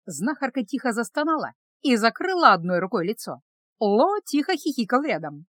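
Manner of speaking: ordinary delivery, nothing marked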